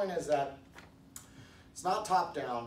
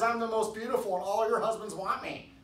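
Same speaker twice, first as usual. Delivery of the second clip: higher-pitched mock vanity; mock boasting; 6 semitones higher, 4 dB louder than usual. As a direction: brag like a self-declared beauty. Third acted boaster.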